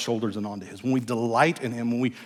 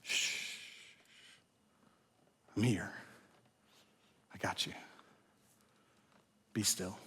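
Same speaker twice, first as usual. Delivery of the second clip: whispered, much softer comfort; soothing hush; 5 semitones lower, 17 dB quieter than usual. Acted. God comforting an anxious heart, whispered and tender. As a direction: hush the listener like a baby. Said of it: "You want peace?".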